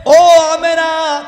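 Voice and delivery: high, loud, drawn-out call; voice from the water. Acted. Voice from the well addresses Amena, drawn-out call. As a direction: call out slowly to summon her. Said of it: "পানির ভিতর থেকে আওয়াজ আসে".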